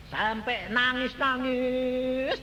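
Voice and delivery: slow, stretched high telling; drawn-out emphasis. Acted. Preacher dramatizing a family's weeping, slow stretched emphasis.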